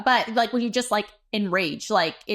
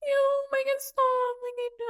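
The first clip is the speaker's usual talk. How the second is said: mock whimpering plea. Inner plea during unwanted kissing.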